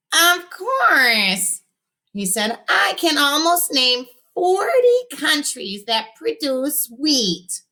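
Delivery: put-on high, slow smug little boy voice; the little genius showing off; know-it-all kid. A narrator's reading. Robert boasting to his friends, high and smug.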